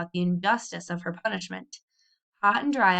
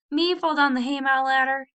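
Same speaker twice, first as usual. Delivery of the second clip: high, loud indignant disbelief; amazed demand; 6 semitones higher, 5 dB louder than usual. A narrator's reading. Hetty to her brother Tom, loud and amazed. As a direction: she can't believe he said that. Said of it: "demanded Hetty in amazement".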